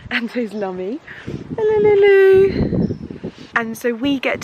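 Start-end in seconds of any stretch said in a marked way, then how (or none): 1.5-2.9 high, loud, slow cooing to the cat; pet talk voice. Real, not acted. Greeting her cat Lulu in the garden, high pet-talk coo.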